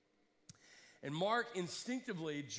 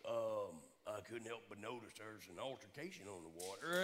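Bubba pier guy; 10 semitones lower, 6 dB quieter than usual. Retold or imitated. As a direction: play the big Southern Bubba running the pier. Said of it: "this big old Bubba kind of guy".

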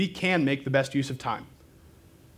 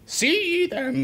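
squeaky, high-pitched attempt at British; bad British accent; 14 semitones higher than usual. Shown as a demonstration. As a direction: botch a British accent, squeaking like a dying rat. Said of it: "like an old dying rat".

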